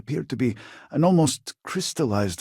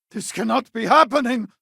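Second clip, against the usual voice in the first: alarmed cry; 4 dB louder than usual. Acted. Alarm as things go wrong.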